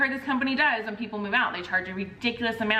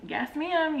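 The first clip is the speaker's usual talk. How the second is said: clipped respectful yes ma'am; "Yes, ma'am" held back and polite; polite restraint; 7 semitones higher than usual. Retold or imitated. Mover keeps calm with the agent, restrained polite voice. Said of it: "trying to refrain myself and be respectful".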